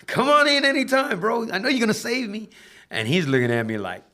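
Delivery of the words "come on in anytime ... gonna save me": much higher, louder pleading call; urging friend. Retold or imitated. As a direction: egg your buddy on, pitched way up. Urging Woods in court.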